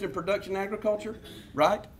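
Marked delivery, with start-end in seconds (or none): none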